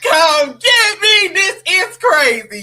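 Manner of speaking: high, loud, drawn-out cry for rescue; comic replay of a distressed phone call; mock panicked plea. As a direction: wail to dad to come and fetch you, hammed up. Retold panicked call to daddy.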